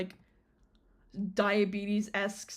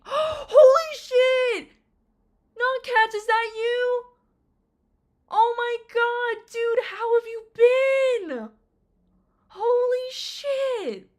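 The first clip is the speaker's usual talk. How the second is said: much higher, louder, slow shriek; shocked delight; 13 semitones higher, 11 dB louder, slower than usual. Real, not acted. She greets a long-lost friend, shrieking with joy. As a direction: scream in joyful shock.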